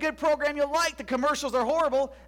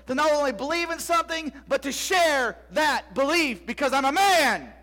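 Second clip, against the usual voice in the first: loud, slowed-down shouted declaration; preaching shout; 3 semitones higher, 4 dB louder than usual. Real, not acted. Pastor rallying the men of the congregation, shouting. Sermon climax about courage.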